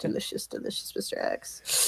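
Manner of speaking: unvoiced, breathy hungry whisper; breathy villain purr. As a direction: savor it like Hannibal Lecter. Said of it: "Were you trying to impersonate".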